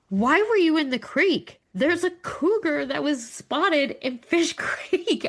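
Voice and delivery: mock the husband's baffled scolding; high, drawn-out how-could-you tone; husband quoted. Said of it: "he's like".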